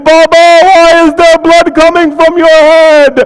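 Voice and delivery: high, loud keening cry of a little daughter; wailing lament. Acted. Preacher voicing little Sakina weeping over her father's head, high loud wail. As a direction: wail each Baba like a broken-hearted child.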